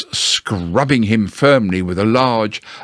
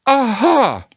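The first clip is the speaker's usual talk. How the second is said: high, weak Piglet aha; a brave try that falls flat; feeble aha; 13 semitones higher than usual. Acted. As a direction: Piglet after his scary ride, weak high aha. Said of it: "but it wasn't very good".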